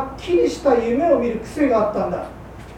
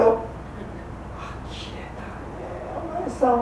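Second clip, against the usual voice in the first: exasperated sigh; 2 semitones higher, 8 dB quieter, much slower than usual. Acted. Wife exasperated at husband.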